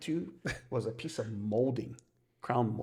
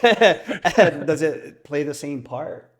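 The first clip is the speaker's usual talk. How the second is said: slow and much louder, laughing as you ask; 6 semitones higher, 14 dB louder, much slower than usual. Real, not acted. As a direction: stretch it out through the laugh. Laughing while asking a question.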